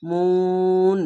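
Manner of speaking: মুন chanted with the ন sustained; মুন intoned, closing ন held as a hum; hold the noon. Shown as a demonstration. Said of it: "নুনকে ধরে".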